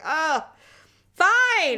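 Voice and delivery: high, loud mock fine; sing-song exasperated fine; grudging agreement. Wife imitating husband giving in, high mocking sigh. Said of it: "He's like".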